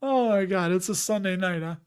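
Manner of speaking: ordinary talk, nothing marked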